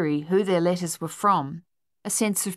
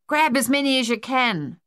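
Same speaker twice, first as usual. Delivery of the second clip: raised, higher urgent call; spluttering shout; 7 semitones higher, 4 dB louder than usual. A narrator's reading. Thaddeus in the pond urging mates on, spluttered shout. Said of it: "Thaddeus spluttered".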